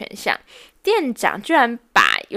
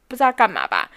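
normal talking voice

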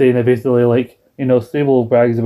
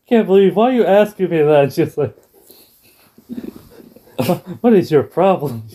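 upset Chyna; 5 semitones higher than usual. Retold or imitated.

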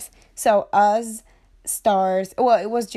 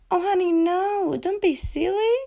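high, drawn-out coaxing voice; put-on sugary denial; sweet-talking fiancée; 10 semitones higher than usual. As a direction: play innocent, cooing in a pitched-up voice. Acts out reassuring rich fiancé.

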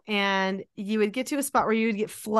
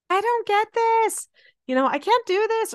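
imitating Quinn whining; 10 semitones higher than usual. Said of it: "so he would say".

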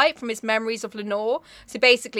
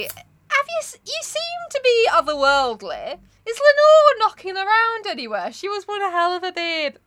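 higher, put-on voice of the lovesick narrator; mock eager narrator; 9 semitones higher than usual. Narrator asking the Raven about Lenore, in a higher put-on voice. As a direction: ask the bird hopefully, overdone.

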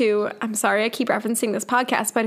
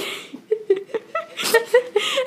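high laugh; 12 semitones higher than usual. Real, not acted. Laughing at the story.

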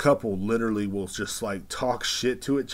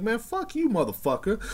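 high-pitched imitation of a cussing wife; retold indignant swearing, pitched way up; mock angry comeback; 13 semitones higher than usual. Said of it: "she's loud".

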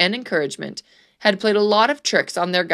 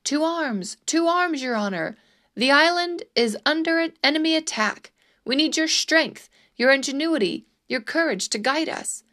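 higher-pitched urgent call to arms; mild shout; 6 semitones higher than usual. A narrator's reading. Townspeople rousing their governor as enemies attack, mild shout.

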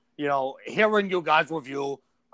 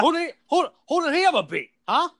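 high, heated trash talk; mock wrestler taunt; 6 semitones higher, 3 dB louder than usual. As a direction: taunt him like a pro wrestler.

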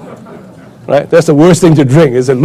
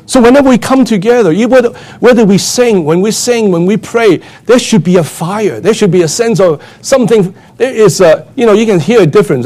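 fiery preaching; 7 semitones higher than usual. Acted. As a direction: preach it with the fire building. Preacher presses the point.

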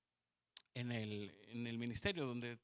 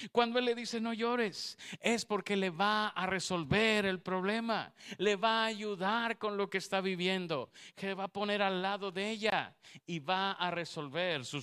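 louder, higher preaching to the women; full-force preaching; 5 semitones higher, 6 dB louder than usual. Acted. Preacher to the women in church, full-force preaching. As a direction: proclaim it from the pulpit.

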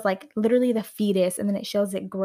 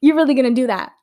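much louder, higher outraged question; loud disbelief; 4 semitones higher, 11 dB louder, faster than usual. Retold or imitated. Reacting to the movie, a loud stunned question. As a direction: blurt it out in shock. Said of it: "it was like".

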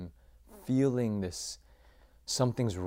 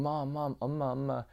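higher-pitched pleading child's call, retold; pleading, raised-pitch retold cry for comfort; crying out for mom; 6 semitones higher than usual. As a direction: re-enact a child calling out for mom. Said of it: "just start crying out for my mom".

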